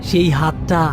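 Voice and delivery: ordinary delivery, nothing marked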